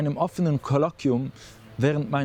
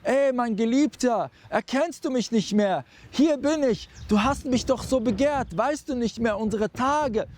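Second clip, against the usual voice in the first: higher-pitched mock call of an old hag; preacher voicing a pitched-up old hag calling out; ugly old woman crying out; 8 semitones higher than usual. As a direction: play the clingy crone calling her lover, pitched up. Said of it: "und sie schreit laut".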